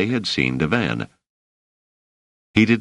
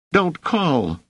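put-on high, squeaky boy's cry; high-pitched urgent protest; shrieked plea; 11 semitones higher than usual. A narrator's reading. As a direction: beg him desperately to stop. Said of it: "shrieked Rache".

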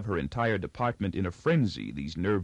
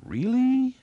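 very high, louder rising "Really?"; wide-eyed amazement; 5 dB louder than usual. A narrator's reading. New girl reacting to gossip, wide-eyed and amazed. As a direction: gush it like an impressed listener. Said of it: "interrupted only with the conventional and wide-eyed".

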